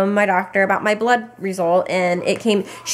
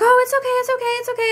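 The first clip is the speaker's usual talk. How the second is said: much higher, sing-song comfort; soothing child; much faster than usual. Retold or imitated. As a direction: hush a toddler about to cry. Mom calming crying toddler.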